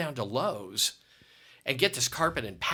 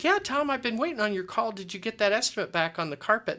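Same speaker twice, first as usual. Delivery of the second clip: higher, louder friendly voice; eager customer; 9 semitones higher, 4 dB louder than usual. Retold or imitated. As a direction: sound glad to hear from him. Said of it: "She's like".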